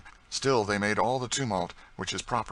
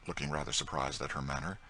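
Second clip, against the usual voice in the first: ordinary delivery, nothing marked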